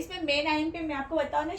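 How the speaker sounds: ordinary talk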